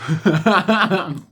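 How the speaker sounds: sudden loud high laugh; high burst of laughter. Real, not acted. Laughs at his own mirror joke.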